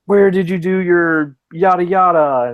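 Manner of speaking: a hint of an incredulous marine; challenging marine. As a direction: demand it like a suspicious soldier.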